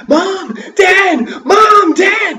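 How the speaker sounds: high, loud desperate calling; shouting for parents. A narrator's reading. Boy shouting to his parents in the clearing, high and loud. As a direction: yell across the field for mom and dad.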